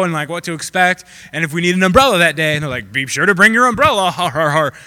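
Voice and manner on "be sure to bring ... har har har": loud, hammed-up TV joker with fake laugh; corny weatherman. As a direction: deliver a lame joke, then guffaw falsely. Mocks weatherman's corny joke.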